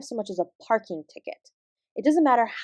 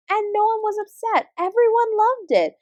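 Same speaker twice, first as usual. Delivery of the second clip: much higher, bright with delight at the happy ending; excited delight; 10 semitones higher than usual. Acted. Teacher telling students how the concert was saved, excited. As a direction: lift it high with glee. Retelling triumphant teacher story.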